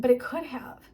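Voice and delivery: ordinary talk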